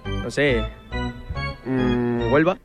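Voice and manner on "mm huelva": normal talking voice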